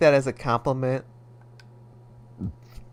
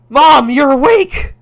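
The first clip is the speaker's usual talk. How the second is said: loud, high, slow alarmed cry; panicked son; 12 semitones higher, 13 dB louder than usual. Acted. Son caught by his mother, loud and panicked. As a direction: blurt it out in panic.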